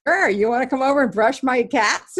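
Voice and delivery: playing the amused neighbor; high amused retelling of a neighbor's offer. Neighbors' amused reply, retold.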